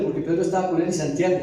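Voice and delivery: normal talking voice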